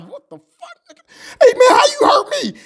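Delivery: very high, crying shout; pained yell. Acted. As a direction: cry out after being blasted. The character is blown up and hurt.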